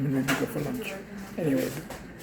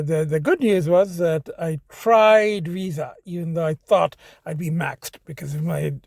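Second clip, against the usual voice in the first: ordinary talk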